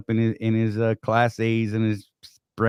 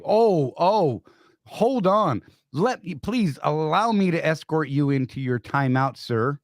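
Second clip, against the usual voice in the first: mock deference; 5 semitones higher, slower than usual. Soldiers mock private with exaggerated courtesy.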